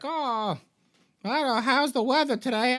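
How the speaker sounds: high-pitched old lady impression. Old lady chatting to Ryu in a high put-on voice. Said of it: "You talk to some old lady".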